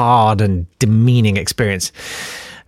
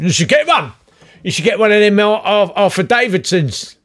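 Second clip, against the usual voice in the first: pushy jobseeker; 9 semitones higher than usual. Retold or imitated. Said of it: "and very angry".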